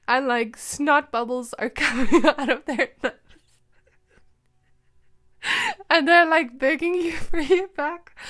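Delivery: real giggles breaking through the words; can't keep a straight face; laughing while talking. Real, not acted. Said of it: "it's making me laugh".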